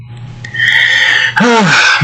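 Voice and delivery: long weary sigh. Real, not acted. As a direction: let out a long audible breath. Facing much remaining work.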